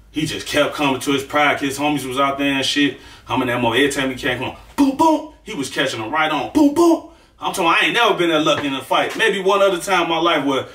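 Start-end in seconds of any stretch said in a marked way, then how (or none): 4.4-5.3 high boom boom for the punches; punch sound effects. Retold or imitated. Replaying the fight's punches, high boom sounds. 6.5-7.1 high boom boom for the punches; punch sound effects. Retold or imitated. Replaying the fight's punches, high boom sounds.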